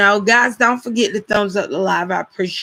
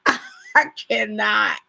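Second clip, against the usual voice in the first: laughing; slower than usual. Real, not acted. Laughing at the mix-up.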